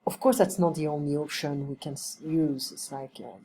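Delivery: ordinary talk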